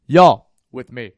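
loud, slow guard's challenge; guard halting guests on the stairs, loud and slow; stern guard call. Retold or imitated. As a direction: stop them cold at the stairs. Security guy stops them.

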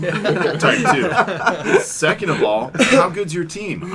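high, loud, laughing sass; slow, laughing put-down; mock snooty laughing. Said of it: "I was like".

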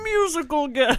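announcer voice. Acted. Mock billing for the matinee show.

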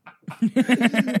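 loud helpless laugh; loud burst of laughter. Real, not acted. Laughing at the bit.

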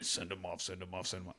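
very soft mock mutter; barely voiced mocking mutter; muttered under breath. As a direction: drop to a sly under-the-breath aside. Muttered aside mocking LBJ.

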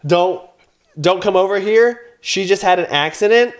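put-on calling to kids. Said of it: "I am telling them through the gate".

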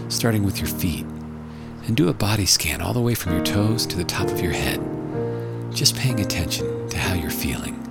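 hushed and calm, guiding relaxation. Acted. Leads a guided meditation.

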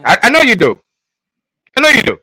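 high, loud and insistent; emphatic teasing agreement. Real, not acted. Host needling co-host who admires the ruthlessness, high and emphatic. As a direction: jump in with a high-pitched knowing jab.

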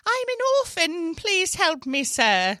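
high, pleading little orphan voice; orphan boy. Acted. Improviser showing off the orphan character, high begging boy voice. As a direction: beg loudly like a small orphan boy. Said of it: "uh, the little boy".

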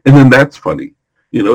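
ordinary talk

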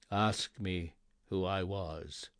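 low, quiet ghostly reply; unearthly voice. A narrator's reading. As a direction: murmur it like a ghost from the grave. Ghost answers Scrooge.

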